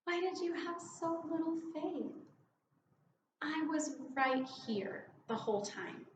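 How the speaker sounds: put-on like a mother to a child; softly reassuring like a parent; loving voice. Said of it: "heard his voice as saying".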